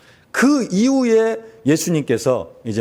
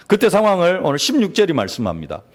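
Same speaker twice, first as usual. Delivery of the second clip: ordinary talk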